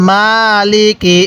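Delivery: slow "মালিকি" with the first vowel drawn out; one-count madd. Shown as a demonstration. Teacher shows students how to read it, slow "মালিকি" with long "মা". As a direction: stretch "মা" in "মালিকি", no stretch on the last syllable. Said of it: "কিভাবে পড়ব?".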